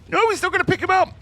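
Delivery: very high, loud, fast disbelieving cry; shocked shout. Real, not acted. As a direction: yell it in disbelief. Caster shocked by play.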